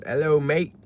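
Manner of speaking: hammed-up little British accent. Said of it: "I read it like a British".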